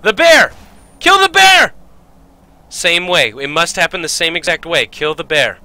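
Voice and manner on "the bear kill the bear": high, loud battle shout; urgent yell. Real, not acted. Gamer yelling to kill the bear, urgent shout. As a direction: shout the order at the top of your range.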